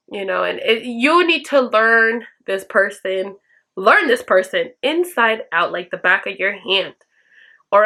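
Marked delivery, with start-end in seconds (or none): none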